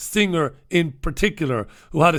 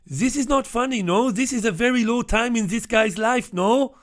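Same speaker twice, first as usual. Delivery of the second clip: put-on German accent; 7 semitones higher than usual. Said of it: "one of the German guys says to me".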